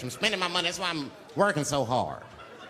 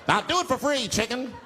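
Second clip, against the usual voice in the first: higher and louder; eager offer; 8 semitones higher, 3 dB louder than usual. Retold or imitated. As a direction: beg the chicken eagerly. Pleading with chicken.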